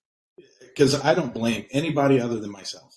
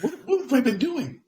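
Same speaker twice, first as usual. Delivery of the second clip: shocked realization; 10 semitones higher than usual. Retold or imitated. Retells own shocked realization.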